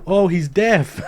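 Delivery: high, loud bystander's cry; imitated call-out. Lady explaining the deaf man, mock loud call. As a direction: call it out like an alarmed onlooker.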